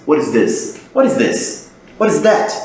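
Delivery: "what is" linked with a flapped T; natural connected speech. Shown as a demonstration. Teacher drills everyday English for a student, naturally linked. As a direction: run "what is" into one word, tapped T. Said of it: "é como as pessoas falam com naturalidade".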